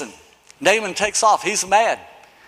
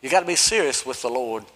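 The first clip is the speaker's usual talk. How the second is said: earnest hush; 9 semitones lower, 7 dB quieter than usual. Real, not acted. Drop to earnest low tone.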